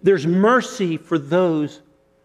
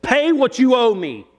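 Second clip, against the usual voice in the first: higher, harsh demand while gripping his throat; 7 semitones higher than usual. Retold or imitated. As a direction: bark the order at the debtor. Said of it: "he demands that he pays him".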